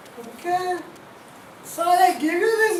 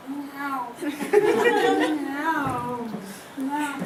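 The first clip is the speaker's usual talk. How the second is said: soft, low mock meow; playful cat meow; 6 semitones lower, 5 dB quieter, much slower than usual. Playing a cat at the mirror.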